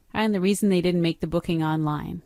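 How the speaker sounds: ordinary talk, nothing marked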